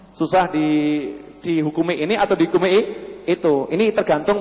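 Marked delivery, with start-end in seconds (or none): none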